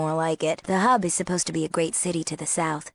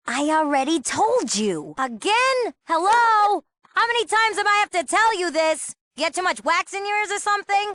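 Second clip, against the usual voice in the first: high, incredulous exasperation; fed up with someone who won't listen; sarcastic, high-pitched impatience; exasperated; 11 semitones higher, 4 dB louder than usual. Acted. Fed up with repeated questions.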